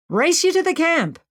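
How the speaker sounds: eager challenge. Acted. Playful challenge to race.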